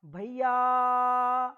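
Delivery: भैया with the final या stretched long and loud; calling out. Shown as a demonstration. Teacher showing how to call out to someone, long and loud. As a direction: call भैया across a distance, holding या. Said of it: "जब हम किसी को संबोधन करते हैं, बुलाते हैं".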